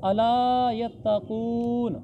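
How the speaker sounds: अला यत्तकून recited slowly with long aa and oo; elongated vowels. Shown as a demonstration. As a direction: stretch the aa in अला. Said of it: "तो फिर खींचते हैं".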